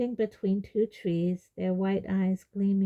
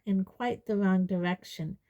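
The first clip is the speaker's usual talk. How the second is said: ordinary delivery, nothing marked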